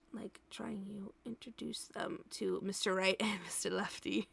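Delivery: whispered, giggling joke. Real, not acted.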